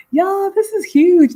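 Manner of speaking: high, loud and slow with delight; excited. Real, not acted. Announcing a livestream milestone to viewers, high thrilled cry. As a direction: savor the news with a thrilled cry. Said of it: "excited about milestone".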